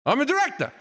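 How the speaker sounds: mock conviction, pitched high; with passion. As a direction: declare it with some passion. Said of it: "With passion".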